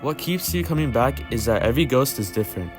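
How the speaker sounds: ordinary talk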